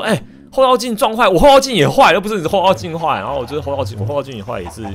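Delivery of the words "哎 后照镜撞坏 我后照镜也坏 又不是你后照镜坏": higher, louder indignant comeback; indignant retort. Retold or imitated. Retelling his comeback to the auntie, loud and indignant. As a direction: protest it loudly, half laughing at the unfairness. Retorting about the broken mirror.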